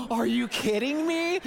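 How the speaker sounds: gasp, then a high, loud outraged question; mock shocked gasp. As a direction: play it as a hammed-up betrayal. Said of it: "I was expecting".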